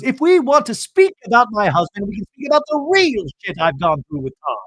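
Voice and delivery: high, loud, indignant Bronwyn imitation; host mimics Bronwyn on a boat, indignant and high. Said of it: "Bronwyn goes".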